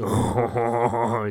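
loud hammy "hon hon hon"; mock French laugh. Frenchman amused by his huge beret, hammed-up laugh. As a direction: guffaw like a cartoon Frenchman.

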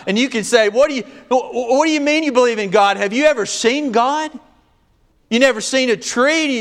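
high, fervent preaching storyteller voice; preaching at full force. Acted. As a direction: preach the story up loud and high, acting out each voice. Preaching climax, acted challenge.

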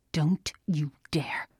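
slow, whispered menace; threatening whisper. A narrator's reading. Father pointing in daughter's face, whispered threat. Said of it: "he threatened".

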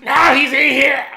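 loud squawked outburst; disgusted cartoon duck. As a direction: shout it like a cross cartoon character. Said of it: "He just sounded disgusted".

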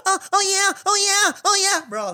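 very high squeaky oh yeahs; mock popcorn voice; popping popcorn impression. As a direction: squeal like popcorn bursting in the microwave. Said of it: "this is me in the microwave popping popcorn".

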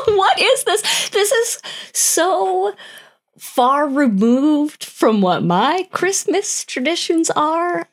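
high, laughing outrage; laughing disbelief. Real, not acted. Host laughing in disbelief at the holiday custom, high. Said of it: "what the fuck is wrong with people?".

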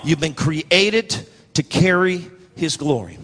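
slow, measured preaching with weight on CREATED; slow emphatic repeat. Real, not acted. Preacher telling congregation their purpose, slow and emphatic. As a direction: spell it out slowly, phrase by phrase.

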